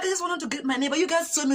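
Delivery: high, hurried-sounding mock plea; acted defensive protest; protesting excuse. Her pleading excuse, acted.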